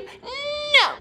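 high shouted refusal. Real, not acted.

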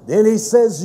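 ordinary delivery

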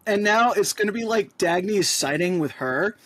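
usual speaking voice

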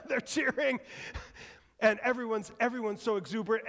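usual speaking voice

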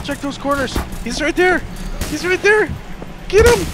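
rising high urgent warnings; urgent alarmed callout. Real, not acted. Warning teammate during chase.

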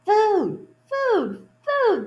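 high, loud bird cries; jays crying food. Acted. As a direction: squawk it like hungry blue jays. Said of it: "they cried again and again".

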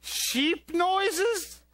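surprised. Acted. Host reacts in disbelief to the puppet's sheep noises.

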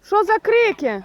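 high, loud startled question. Real, not acted. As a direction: react to the sudden racket. Goose suddenly screeching. (високо і гучно дивується крикам)

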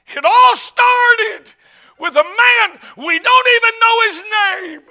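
high, loud, long-drawn revival cry; soaring sermon climax; preacher's shout. Real, not acted. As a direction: belt it out slowly to the congregation.

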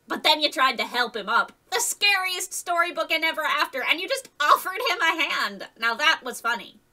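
mock him for his kindness. Wolf boy mocking Alex.

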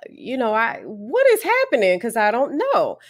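hushed bafflement rising into a high puzzled question; confused pleading. Retold or imitated. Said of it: "And I'm like".

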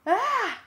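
very high loud startled cry; sharp yell. A narrator's reading. Alien friend cries out nearly stepped on, high loud. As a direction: cry out as something nearly crushes you. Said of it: "Ax yelled".

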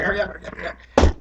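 hushed, frantic repeated muttering; scared urging. Acted. Person secretly peeing outside, nervous hushed urging. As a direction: urge yourself on under your breath. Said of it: "I was worried".

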